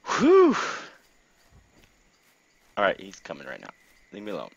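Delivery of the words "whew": loud high whoop of relief; short hooted exhale; high relieved whew. Real, not acted. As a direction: let out a sharp high whew. Relief after danger.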